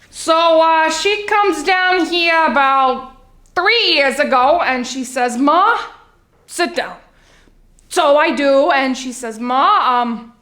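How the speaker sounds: chatty Long Island mother telling all; dramatic housewife with drawn-out punchlines; Sheila, Long Island housewife. Acted. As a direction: play a confiding, theatrical suburban mom. Plays monologue housewife Sheila.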